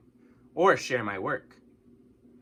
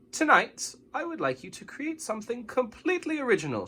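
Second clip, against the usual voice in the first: a hint of a bright teacher, higher; teacher announcing; 11 semitones higher than usual. A narrator's reading. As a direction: announce it cheerfully to the class. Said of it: "she announced".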